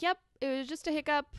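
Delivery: high, loud, quick fake reassurance; chirped, hurried "all fine" act; breezy brush-off. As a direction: act out brushing off a worried mom. Brushing off her worried mom.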